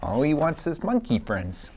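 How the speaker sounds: ordinary delivery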